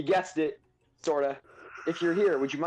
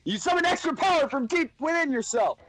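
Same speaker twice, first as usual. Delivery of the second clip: high, loud rallying cry; hyped-up determination; 12 semitones higher, 4 dB louder than usual. Acted. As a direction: psych yourself up to win. Psyching up, rising.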